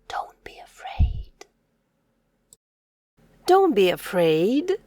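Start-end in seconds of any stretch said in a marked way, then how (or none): 0.1-1.5 whispered reassurance. Shown as a demonstration. Teacher whispering comfort to a scared child. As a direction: breathe it softly, no voice.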